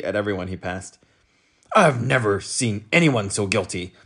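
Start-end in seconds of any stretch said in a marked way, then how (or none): 1.8-3.9 gruff little officer; stern policeman. A narrator's reading. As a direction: play the fierce short cop. Policeman voiced.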